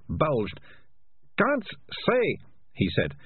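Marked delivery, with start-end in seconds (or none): 1.4-2.4 high, squirming character voice; strained refusal. A narrator's reading. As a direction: force the words out high, as if fighting them. Ratcatcher struggling to keep secret.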